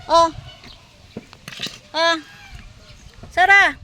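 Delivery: loud, high call across the yard; shouting a dog's name to come eat. Real, not acted. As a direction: call the hiding dog from a distance. Calling dog hiding under car.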